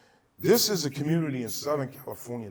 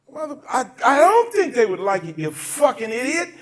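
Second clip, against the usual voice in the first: higher, louder angry rant; inner angry rant; 10 semitones higher, 8 dB louder than usual. Retold or imitated. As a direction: vent what you wanted to yell at him. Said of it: "That's what I'm thinking".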